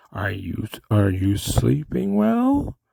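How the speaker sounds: put-on kooky old guy, slowly sliding upward; old doctor. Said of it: "This is the old guy in the film".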